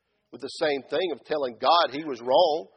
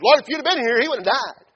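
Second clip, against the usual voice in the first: accusing, higher and louder retort; with an attitude; 9 semitones higher, 9 dB louder than usual. Acted. Martha confronting Jesus over her brother's death, with attitude. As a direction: throw it at him with attitude. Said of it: "Martha come pretty much with an attitude".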